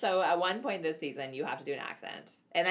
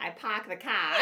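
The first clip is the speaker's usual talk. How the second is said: Boston accent with dropped Rs; R-less Bostonian "pahk the cah"; slower than usual. Shown as a demonstration. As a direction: try a Boston accent, amused. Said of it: "how to speak Bostonian ac".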